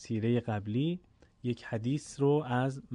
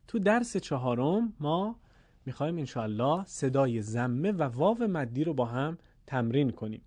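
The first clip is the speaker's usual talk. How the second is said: ordinary talk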